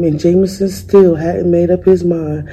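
ordinary delivery

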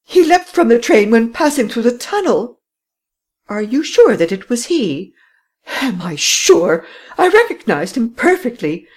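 high, loud woman's voice, adamant; lady swearing she recognized him; lady insisting to the commissary; insistent lady. A narrator's reading.

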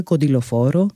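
ordinary delivery

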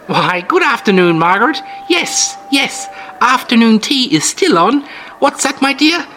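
put-on high, chirpy old-lady voice; sweet old lady. Old hostess brags about her jam, sweet high voice. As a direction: play the sugary granny host, all charm. Mrs Anders on the phone.

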